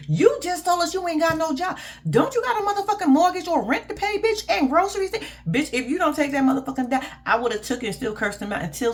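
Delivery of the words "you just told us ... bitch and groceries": high-pitched, indignant scolding; high, outraged disbelief; exasperated scolding. Real, not acted. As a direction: pile on the questions in high exasperation. Scolding her for refusing the money.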